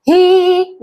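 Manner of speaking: ही with a long, held ee; long ee sound. Shown as a demonstration. Teacher showing बड़ी ई, ही drawn right out. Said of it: "बड़ी ई से".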